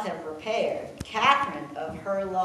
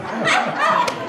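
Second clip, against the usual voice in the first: loud, very high laugh; real helpless laugh; high shriek of laughter; 11 dB louder than usual. Real, not acted. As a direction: burst out laughing. Laughing at pony biting story.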